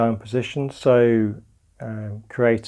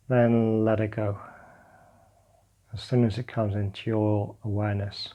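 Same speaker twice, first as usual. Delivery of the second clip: calm meditation guidance, drifting softer; guided meditation; 3 dB quieter than usual. Acted. Teacher guiding listeners lying on the floor through a body scan, calm meditation voice. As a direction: guide them gently into letting go.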